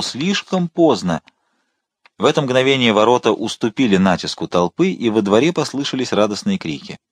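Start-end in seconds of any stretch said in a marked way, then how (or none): 0.0-1.2 high, drawn-out wail at moderate volume; despair. A narrator's reading. Cardinal clutching his head, drawn-out despair. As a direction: stretch it slowly, pitch raised, hopeless. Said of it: "хватаясь за голову".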